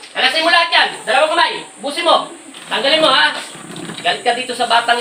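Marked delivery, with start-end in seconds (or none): 0.1-3.3 high-pitched, insistent orders; urgent commands. Real, not acted. Healer orders all of it removed, high and urgent. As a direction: drive each order home, pitched up.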